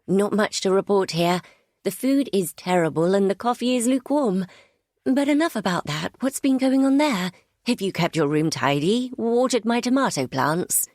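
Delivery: breezy. A narrator's reading. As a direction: hurry him onto happier topics. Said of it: "trying to keep my tone breezy".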